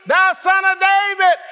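high, ringing cry for mercy. Acted. Blind Bartimaeus begs Jesus for mercy, crying out. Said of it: "What did he cry out?".

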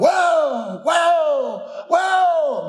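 high calling whoa to runaway horses; hollering whoa. Retold or imitated. To the runaway horses, a high hollered whoa. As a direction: yell it high to stop the horses. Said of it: "I was hollering".

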